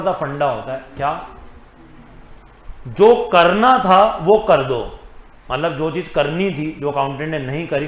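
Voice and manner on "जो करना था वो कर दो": slow, louder and deliberate, word by word; emphatic key rule. Real, not acted. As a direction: drum the rule into the students. Announces key rule to memorise.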